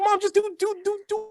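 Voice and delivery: very high, pushy whine; mock squeaky pleading. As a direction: squeak it out like a kid begging. Pressuring mom to smoke, squeaky voice.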